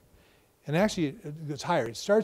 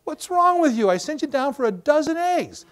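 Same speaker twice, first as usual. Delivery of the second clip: high, loud exasperated scold; sharp raised complaint; scolding spouse; 8 semitones higher, 5 dB louder than usual. Retold or imitated. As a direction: spouse chewing out husband. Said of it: "your spouse tells you".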